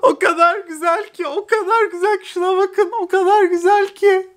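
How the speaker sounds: high, tearful voice breaking; crying with joy. Real, not acted. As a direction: sob it out in happy disbelief. Overwhelmed by signed photo.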